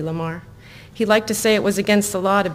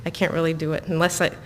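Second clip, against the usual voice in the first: ordinary delivery, nothing marked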